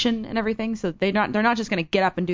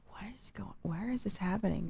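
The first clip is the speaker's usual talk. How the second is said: whisper; 15 dB quieter than usual. Retold or imitated. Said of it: "you're like".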